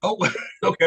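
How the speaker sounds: normal talking voice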